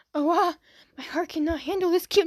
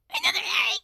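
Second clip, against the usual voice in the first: very high gasping shout; high delighted gasp-shout; surprised shout. Real, not acted. Shocked delight at another Eri.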